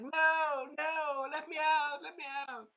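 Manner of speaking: high, slow mock plea; pleading to be let out. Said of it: "And the bitch is like".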